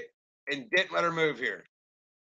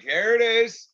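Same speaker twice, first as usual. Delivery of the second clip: gleeful vindication; 5 semitones higher, 4 dB louder than usual. Retold or imitated. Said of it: "I'm like".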